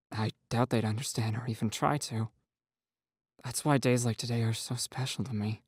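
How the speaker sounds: soft, sad confession; sad Nathan. A narrator's reading. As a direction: quietly admit the pain. Nathan voiced in story.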